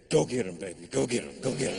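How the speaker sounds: low whiskey voice egging him on; Jack Daniels. Acted. Whiskey sends comedian after the women, low urging. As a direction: urge him on low, like a devil on the shoulder. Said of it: "Jack Daniels said".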